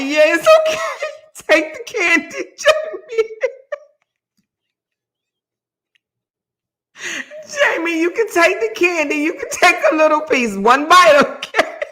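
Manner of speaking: high, giggling imitation of an indulgent okay; laughing mock permission. Host mimics Kevin letting Jamie take candy, high and laughing. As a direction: grant permission while cracking up. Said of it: "he nodded his head like".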